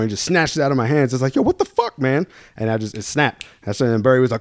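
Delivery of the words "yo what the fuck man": high-pitched outraged protest. Retold or imitated. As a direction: squeak out a shocked protest. Protesting bartender snatching beer.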